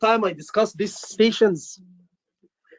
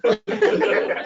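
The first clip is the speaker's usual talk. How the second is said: laughing; 12 semitones higher than usual. Real, not acted. Laughs at colleague's joke.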